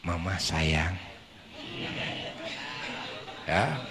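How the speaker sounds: soft, tender coaxing voice; low tender murmur; gentle waking. Shown as a demonstration. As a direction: wake her softly like a sleeping child. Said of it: "Begini caranya".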